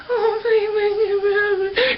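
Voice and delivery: high, whimpering plea; pitiful high-voiced begging; tearful begging. Whimpering medicated patient begging.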